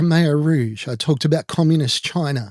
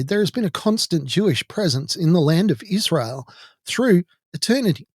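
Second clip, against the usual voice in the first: usual speaking voice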